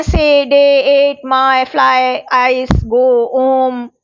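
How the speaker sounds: diphthong words listed one by one, vowels drawn out on a level tune; teacher's measured list of example words; gliding vowel examples. Shown as a demonstration. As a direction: sound out each word so its vowel glides. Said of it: "कुछ और example हैं".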